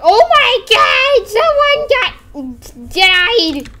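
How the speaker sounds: very high, loud screech; shocked coworker. Acted. Coworker finding someone dead, high horrified shriek. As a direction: scream it out, stretched in horror. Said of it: "the coworker was just like".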